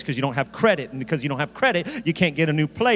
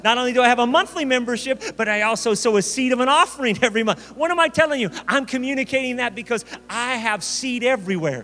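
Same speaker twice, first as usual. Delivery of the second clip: higher, driving preacher delivery; impassioned preaching; 6 semitones higher than usual. Real, not acted. Preacher urging the congregation to sow seed, fervent preaching. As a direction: press the point home with rising fervour.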